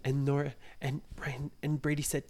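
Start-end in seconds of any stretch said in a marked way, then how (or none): none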